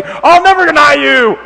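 loud, drawn-out mad outburst; mock scream. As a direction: yell it high, like hot-headed Peter. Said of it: "scream at him and say".

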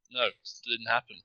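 ordinary talk

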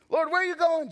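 high, loud startled Peter; blurting Peter. Acted. Peter voiced in retelling.

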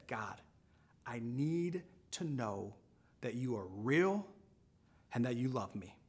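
slow, low, heartfelt prayer; earnest plea. Retold or imitated. Cashier praying alone in her car, earnest subdued plea. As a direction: plead quietly, pausing between phrases.

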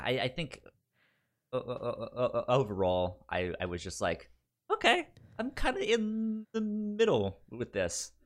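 ordinary talk

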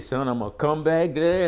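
stern teacher. Retold or imitated. Teacher threatening the class.

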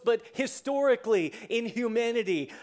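usual speaking voice

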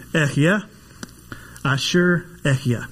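"Echya" said slowly as eh-hee-ya, "Asher" as ah-sher with a light h; careful Hebrew pronunciation. Shown as a demonstration. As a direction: sound out "Asher Echya" syllable by syllable. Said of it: "do my best to pronounce it".

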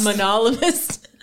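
"monologist" said slowly, every syllable clear, stress on -no-; careful pronunciation. Shown as a demonstration. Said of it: "a monologist".

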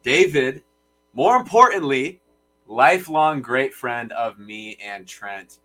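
slow, booming arena announcer; hype announcer intro. Acted. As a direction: announce him like a ring introduction.

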